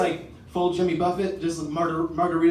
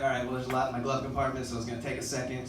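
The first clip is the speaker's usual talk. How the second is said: rushed stalling; 7 semitones lower, 6 dB quieter, faster than usual. Retold or imitated. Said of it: "I was like".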